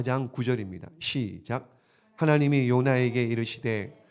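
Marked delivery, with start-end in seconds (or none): 2.2-4.0 scripture reading. Acted. Reads scripture aloud.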